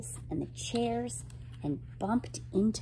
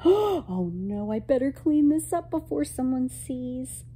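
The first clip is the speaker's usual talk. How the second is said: higher, worried storybook voice; alarmed; 6 semitones higher, 4 dB louder than usual. Acted. Girl has made a mess in the museum, alarmed. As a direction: fret aloud about being caught. Girl panics over the broken picture.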